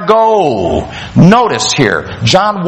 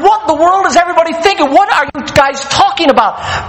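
acted incredulous outburst, starting high and fast; stunned disbelief; 5 semitones higher, faster than usual. Preacher voicing John at false teachings, stunned and incredulous. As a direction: fire off the question in mock bewilderment.

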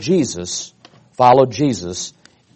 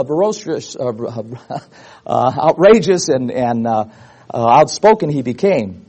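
usual speaking voice

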